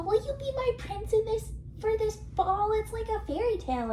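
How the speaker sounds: high, sweet princess voice; mock Barbie voice. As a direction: ask dreamily like a fairy tale princess. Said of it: "she's all".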